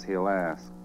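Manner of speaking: ordinary delivery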